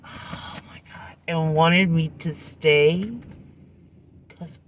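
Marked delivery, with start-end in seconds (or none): 0.1-1.2 whispered oh my god. Real, not acted. Aside in disbelief.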